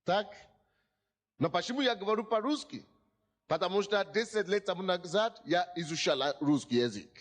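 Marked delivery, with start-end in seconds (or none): none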